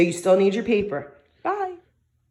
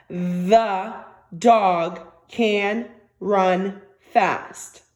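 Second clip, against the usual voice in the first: each word spaced out, very slow; very slow dictation; much slower than usual. Shown as a demonstration. Teacher to young students, dictating the sentence very slowly. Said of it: "try to write the sentence".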